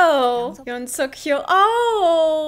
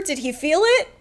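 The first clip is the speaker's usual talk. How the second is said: usual speaking voice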